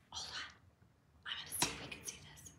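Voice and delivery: hushed, voiceless whisper; whispered aside, barely audible; real whisper. Real, not acted. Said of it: "no idea why I'm whispering".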